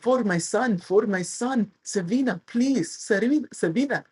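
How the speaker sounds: put-on Russian accent. Russian fan begging an autograph for his son, mock Russian accent. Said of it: "Russian accent".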